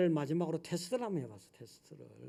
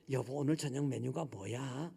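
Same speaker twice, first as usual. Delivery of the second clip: softer, lower, patient question; patient gentle ask; 5 semitones lower, 6 dB quieter than usual. Retold or imitated. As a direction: ask kindly while stroking her back. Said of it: "인내심이 필요해". (아내 등을 만지며 부드럽게 묻는 목소리)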